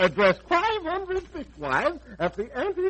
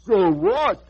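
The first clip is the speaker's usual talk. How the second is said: outraged, louder retort; indignant echo; 6 dB louder than usual. Acted. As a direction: throw the words back in disgust. Indignant echo of bribery offer.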